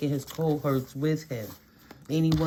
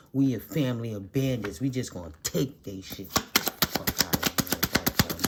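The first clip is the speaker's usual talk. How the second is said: much lower mock thug voice; a gruff, lowered mock boast; mock bandit; 9 semitones lower than usual. Mocking the thieving family with a lowered voice.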